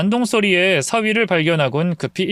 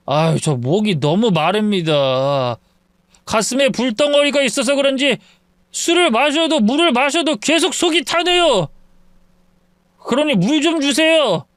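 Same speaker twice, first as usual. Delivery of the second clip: put-on a drunk man hollering at his in-laws' door; drunk son-in-law; 6 semitones higher than usual. (술 취한 사위의 높은 외침)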